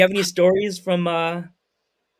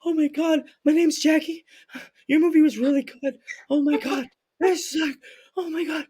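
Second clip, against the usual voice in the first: much higher, breathless fan gushing; starstruck gushing; 10 semitones higher than usual. Retold or imitated. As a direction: gush like meeting your first celebrity. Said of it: "she was freaking out".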